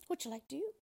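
a frightened queen, lightly voiced; mild distressed cry. A narrator's reading. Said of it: "she cried".

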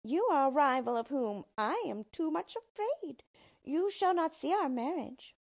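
high, loud little villain; high-pitched, forceful dwarf voice; menacing dwarf. A narrator's reading. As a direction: threaten your rival in a high voice. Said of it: "replied the yellow dwarf".

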